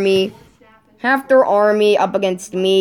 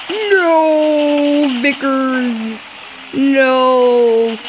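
long drawn-out, raised cry of loss; hammed-up despair, stretching each "no"; mock wailing; 4 semitones higher, much slower than usual. Mock wail as bomber is lost.

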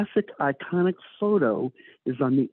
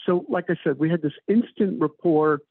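ordinary delivery

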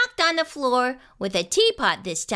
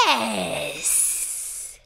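low, soft monster hiss; 6 semitones lower, 5 dB quieter than usual. A narrator's reading. Monster answering the child's request, long low hiss. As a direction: let the final s hiss on and on. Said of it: "he hissed".

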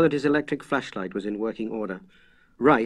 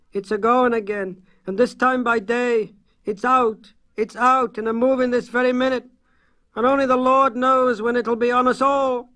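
high, loud frantic country voice; panicked rustic; 13 semitones higher, 6 dB louder than usual. Acted. A frightened farmer cries the warning to the Arkham men. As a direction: blurt it out half sobbing. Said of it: "sobbing out words".

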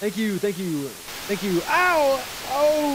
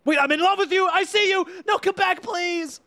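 much higher, louder pleading calls; mock desperate yelling; 12 semitones higher, 4 dB louder than usual. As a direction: shout after them like a lovesick fool. Calling after fleeing NPC.

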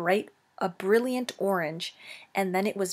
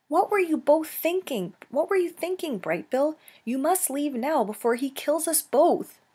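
urgent scolding; 7 semitones higher than usual. Acted. Mother scolds gosling in danger.

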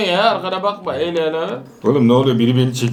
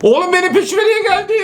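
panicked scream. Real, not acted. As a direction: scream as the monster closes in. Monster chases the player in game. (peşinde canavarla panik çığlığı)

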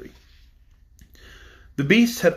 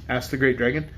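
ordinary delivery, nothing marked

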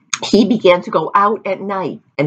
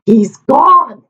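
relieved villager; 8 semitones higher, 6 dB louder than usual. A narrator's reading.

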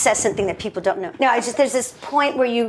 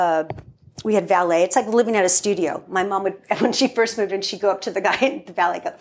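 ordinary talk, nothing marked

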